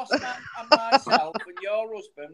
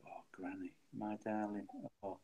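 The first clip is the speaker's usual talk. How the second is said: mushy and lovestruck; 11 semitones lower than usual. Pretend. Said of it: "Keith would be all mushy over Granny's voice".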